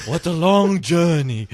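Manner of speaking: slow, exaggerated weariness; mock exhausted. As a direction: draw it out like an epic trek. Mocking the trip.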